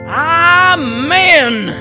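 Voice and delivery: long, loud, drawn-out amen; big rising preacher cry, held long; preacher's amen. Acted. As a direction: belt it out to end the sermon. Preacher closes his parody church ad.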